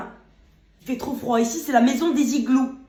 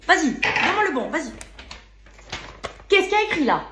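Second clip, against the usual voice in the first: angry; 7 semitones higher, 5 dB louder than usual. Acted. Waitress quarrels with colleague.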